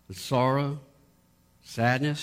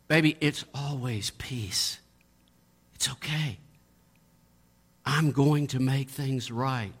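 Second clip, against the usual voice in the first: tender reassurance. Pretend. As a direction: soothe them like a frightened child. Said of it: "he's always going to start with".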